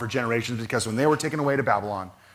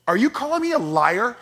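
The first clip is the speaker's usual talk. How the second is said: retold retort; 9 semitones higher, 4 dB louder than usual. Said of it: "and I am just angry".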